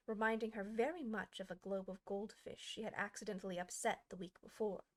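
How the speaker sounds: ordinary delivery, nothing marked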